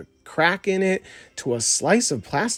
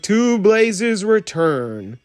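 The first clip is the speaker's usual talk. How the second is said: slow, formal raised-glass toast; solemn toast; 6 semitones higher, 3 dB louder, much slower than usual. Acted. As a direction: propose the toast slowly. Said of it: "raised his teacup and said".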